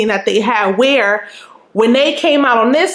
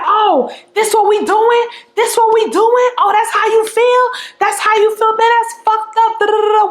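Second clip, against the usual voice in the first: going in; 9 semitones higher than usual. Retold or imitated. Confronting Leon in the park, high mock indignation. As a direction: pile on the fake outrage. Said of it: "I'm going in".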